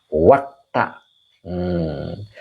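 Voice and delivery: normal talking voice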